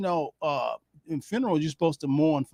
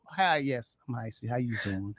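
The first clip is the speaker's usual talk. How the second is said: much softer and lower, subdued hello; 6 semitones lower, 7 dB quieter than usual. Shown as a demonstration. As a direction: bring it down for the mourners. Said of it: "lower your voice".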